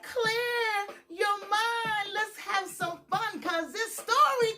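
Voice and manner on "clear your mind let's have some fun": ordinary delivery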